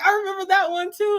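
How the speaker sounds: high-pitched fan delight; excited recognition. Retold or imitated. Fan shown a second photo, retold high-pitched excitement. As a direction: pipe up that you know it.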